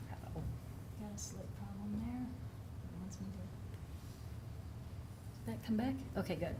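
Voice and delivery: whispering to himself over a tech hitch; whispered aside. Real, not acted. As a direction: mutter off-mic about the glitch. Aside about a microphone problem.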